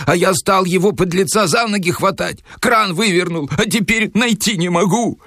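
very high, agitated complaint; acted panic at ordinary volume; frantic explaining. A narrator's reading. Шариков frantically explains through noise.

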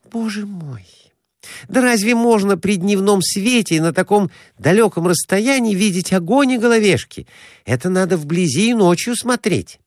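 high-pitched exasperated woman; exasperated Glafira Semyonovna. A narrator's reading. Woman correcting a man who expected visible flames, exasperated. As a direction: explain impatiently in a high woman's voice.

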